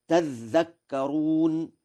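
تذكرون with a long doubled dh, slowly; doubled dhal. Shown as a demonstration. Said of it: "بتشديد الذال". (تذكرون بتشديد الذال)

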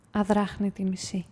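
usual speaking voice

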